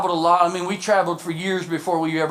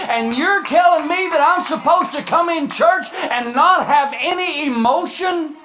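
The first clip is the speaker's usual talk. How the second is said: high, loud, incredulous preacher; impassioned sermon shout; full-force preaching; 9 semitones higher, 5 dB louder than usual. Real, not acted. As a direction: fire off indignant rhetorical questions to the congregation.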